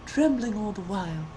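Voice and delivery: ordinary delivery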